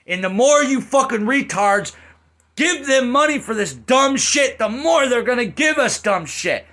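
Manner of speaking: loud, high angry ranting. Real, not acted. Reviewer ranting at fans about money, angry.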